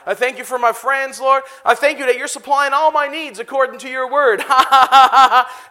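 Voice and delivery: exuberant prayer broken by bursts of laughter; joyful prayer. Acted. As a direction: thank God out loud, laughing between lines. Preacher leading joyful prayer with laughter.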